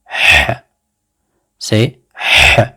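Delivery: H as a voiceless breathy h from the throat; teacher modelling the letter H; Arabic h sound. Shown as a demonstration. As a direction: breathe out H like a sigh. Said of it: "c'est le son H".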